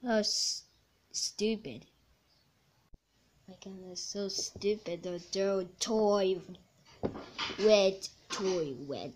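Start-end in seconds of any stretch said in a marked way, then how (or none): none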